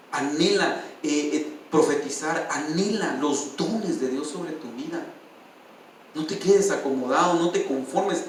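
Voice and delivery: earnest sermon appeal, pleading with the flock; preaching exhortation. Acted. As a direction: urge them on like a pastor.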